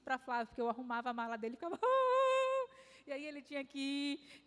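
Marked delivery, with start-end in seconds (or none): 1.8-2.3 sudden high loud cry of distress; a retold wail, much higher and louder. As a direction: burst out in a mock sob. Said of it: "Eu não sei porque que eu tô chorando".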